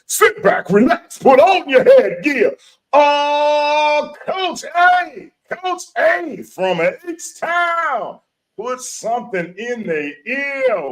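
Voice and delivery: high, loud, stretched-out announcer hype; hyped radio host intro. Acted. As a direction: pump up the crowd like a live radio show host.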